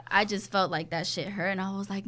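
ordinary talk, nothing marked

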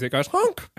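high squeezed honk noise; silly clown honk. Pretend. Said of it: "when I give it a squeeze".

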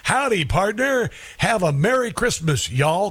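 slow, folksy American drawl; mock Southern American accent; American cowboy accent. Shown as a demonstration. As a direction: demonstrate a hammed-up American twang. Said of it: "using an American accent".

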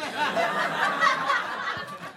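high squealing laugh. Real, not acted.

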